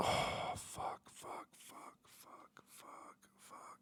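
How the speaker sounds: whispered fucks fading to nothing; defeated whisper. Pretend. Streamer giving up on the room review, fading whisper. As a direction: let the swearing die out under your breath. Defeated muttering after the meltdown.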